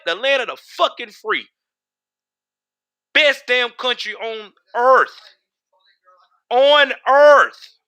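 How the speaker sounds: rally the viewers like a preacher; fired-up patriotic rant. Real, not acted.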